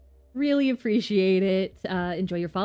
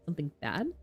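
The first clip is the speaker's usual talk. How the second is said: quiet echo; 3 semitones lower, 6 dB quieter, faster than usual. Acted.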